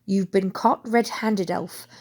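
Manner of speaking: plain reading voice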